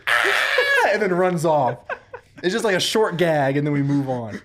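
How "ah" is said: high startled shriek back; answering scream. Acted. The skeleton shrieks right back at the lady. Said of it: "And then he goes".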